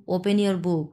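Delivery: ordinary delivery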